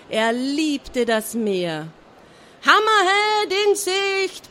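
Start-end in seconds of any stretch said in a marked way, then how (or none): 2.7-4.5 high, slow called-out shout; lookout's shout. A narrator's reading. Lookout Schrottauge shouting the sighting down to deck. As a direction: call it down from the crow's nest. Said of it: "brüllte Schrottauge aus dem Ausguck".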